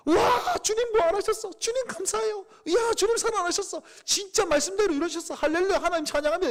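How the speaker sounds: overjoyed praise. Retold or imitated. Disciple rejoicing that the Lord rose, ecstatic and loud. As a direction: shout it out like you can't believe he's alive. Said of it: "감격과 감사와 감동이 있어서".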